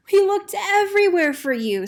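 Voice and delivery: high, loud relieved mother; a mother finding her lost chick; mother penguin. Acted. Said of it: "said his mother".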